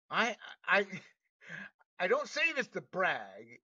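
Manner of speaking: normal talking voice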